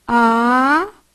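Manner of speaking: Ha-va-í split slowly into syllables. Shown as a demonstration. Teacher splitting Havaí into syllables for the class. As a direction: spell out Havaí one syllable at a time. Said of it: "Como é que eu separo o Havaí?".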